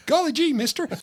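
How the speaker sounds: put-on high, eager old-time kid voice; gee-whiz kid, wide-eyed and keen; wholesome 1950s kid. Reply in kid voice.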